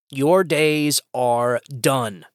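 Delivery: "Your days are done" dragged out slowly, each word weighted; slow warning. Real, not acted. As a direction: spell it out slowly to the party establishment. Repeated slow warning to rivals.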